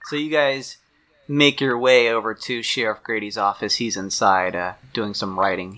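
usual speaking voice

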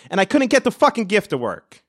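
usual speaking voice

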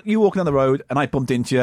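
usual speaking voice

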